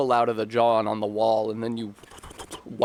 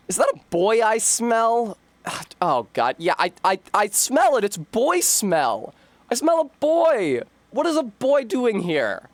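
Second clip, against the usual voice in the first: angry read; 8 semitones higher than usual. Acted. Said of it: "I should have done an angry read".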